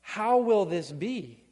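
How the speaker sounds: slow, loud anguished cry. Retold or imitated. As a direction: cry it out slowly to the angel. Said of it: "she cries out in verse thirty-four".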